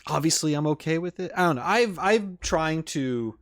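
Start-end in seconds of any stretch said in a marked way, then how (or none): none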